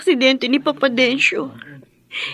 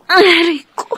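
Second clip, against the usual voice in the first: loud, higher cry of sudden pain; 4 semitones higher, 5 dB louder than usual. Acted. Daughter suddenly hit by stomach pain, crying out. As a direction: clutch your stomach and wince aloud.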